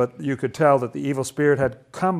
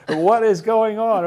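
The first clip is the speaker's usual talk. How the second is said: higher, louder, drawn-out disbelief; amazed; 7 semitones higher, 5 dB louder, slower than usual. Acted. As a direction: exclaim like an astounded onlooker. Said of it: "I would say".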